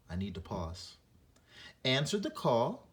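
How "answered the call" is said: expressive read-aloud. Shown as a demonstration. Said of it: "continue using the punctuation marks".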